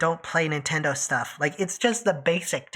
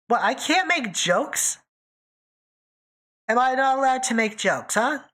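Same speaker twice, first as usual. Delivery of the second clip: higher-pitched, playfully affronted questioning; mock indignation; 6 semitones higher than usual. Streamer teasing chat over being allowed to make jokes, mock indignation. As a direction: protest with pretend offense.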